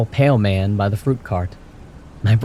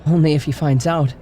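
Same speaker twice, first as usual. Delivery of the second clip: put-on sly girl; 7 semitones higher than usual. A narrator's reading. Daughter voiced in story.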